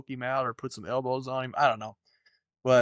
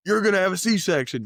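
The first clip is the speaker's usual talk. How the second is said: higher, louder mock demand; mock bossy order; 7 semitones higher, 5 dB louder than usual. Husband ordering wife about surgery, mock loud demand. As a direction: bark it like a pushy husband.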